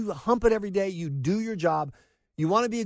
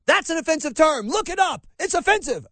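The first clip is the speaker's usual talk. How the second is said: high-pitched, louder mock outrage; mocking indignation; 9 semitones higher, 4 dB louder than usual. Host mimics reporter with no question, mock indignant. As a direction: parrot a scolding reporter, much exaggerated.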